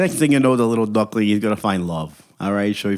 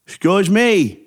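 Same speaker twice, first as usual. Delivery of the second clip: indignant demand; 6 semitones higher than usual. Acted. Dominic demanding respect from Carmine, indignant. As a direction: act affronted and demand your due.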